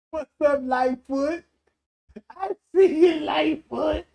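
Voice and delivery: high, loud call across the room to Lightfoot. Real, not acted. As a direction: shout her name like greeting her from afar. Calling out to Lightfoot on screen.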